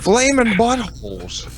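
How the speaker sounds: high, slow hammed-up cry; slow, high, silly proclamation; mock dramatic. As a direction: belt it like a cartoon announcer.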